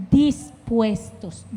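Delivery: stretched slowly; slow emphatic word; drawn out. Real, not acted. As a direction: let it hang. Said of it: "repeated for emphasis".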